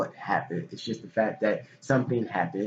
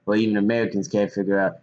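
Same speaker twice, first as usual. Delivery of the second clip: ordinary talk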